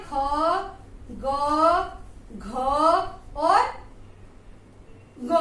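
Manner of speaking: each consonant said alone with long pauses; slow consonant sounds. Shown as a demonstration. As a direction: sound out kha, ga, gha one by one. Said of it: "उसमें कौन कौन से आते हैं?".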